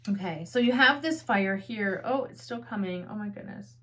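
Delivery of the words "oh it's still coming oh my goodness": held-back sneeze, quiet and strained; fighting off a sneeze; about to sneeze. Real, not acted. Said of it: "Sorry, I'm gonna sneeze".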